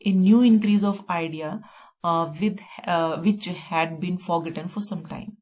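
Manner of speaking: slow, careful dictation. Shown as a demonstration. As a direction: spell it out slowly for students. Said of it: "teacher dictating definition".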